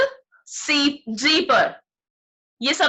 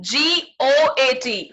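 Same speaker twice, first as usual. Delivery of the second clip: जी ओ ए टी spelt out letter by letter, slowly; slow spelling; much slower than usual. Shown as a demonstration. Teacher spelling a word slowly for children. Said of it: "spelling out goat slowly".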